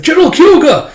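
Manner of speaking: very high, very loud name cry; alarmed shout. Acted. Soldier cries to general struck by claw, alarmed shout.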